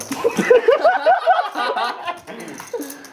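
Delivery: high laugh. Real, not acted. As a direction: burst of high-pitched laughter. Laughing at tilt remark.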